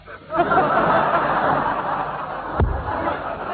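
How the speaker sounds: high unvoiced shrieking cackle; cackling at the photo of him in the bath; shrieking laugh. Real, not acted. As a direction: let out a shrill gleeful cackle.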